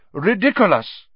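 high, loud incantation; emphatic professor casting a charm; crying out a spell. A narrator's reading. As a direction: call it out as a demonstration. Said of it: "and cry".

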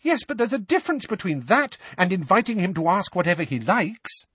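high, fast protest; indignant objection. A narrator's reading. Mrs Weasley objects to Harry asking whatever he likes, indignant. Wife argues back at her husband.

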